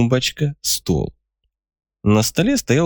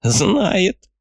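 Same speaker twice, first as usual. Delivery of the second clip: put-on high giggling old man voice; chuckling old man; 9 semitones higher than usual. A narrator's reading. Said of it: "хихикнул старик".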